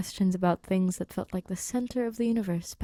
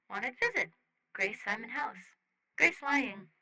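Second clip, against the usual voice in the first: raised, softer, choppy alien speech; light alien Rocky voice; 4 semitones higher, 4 dB quieter than usual. Acted. Rocky explaining his visit to Grace, lightly voiced alien. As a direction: clip the words like an eager little alien.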